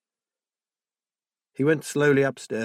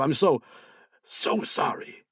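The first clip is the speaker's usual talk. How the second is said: breaking into a breathless near-sob; words falling apart, slower on the last; voice cracking; 4 semitones higher, slower than usual. Acted. Guardian apologising to Will, voice cracking. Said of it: "Poor Fauve sounded ready to crack".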